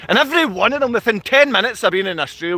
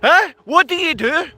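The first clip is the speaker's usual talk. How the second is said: usual speaking voice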